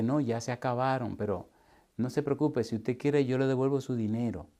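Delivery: soft, low conciliatory voice; hushed, unruffled retold reply; meek placating. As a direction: imitate someone quietly offering to make amends. Retold man placating the unhappy woman.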